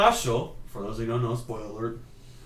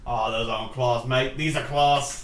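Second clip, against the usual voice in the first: mock Australian. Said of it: "Crocodile Dundee makes an appearance".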